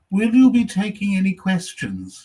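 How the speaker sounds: slow, lightly voiced prim lady; prim older lady. Heckling audience member asking the comic, lightly prim lady voice. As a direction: play the polite Surrey matron, slowly. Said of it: "She just said".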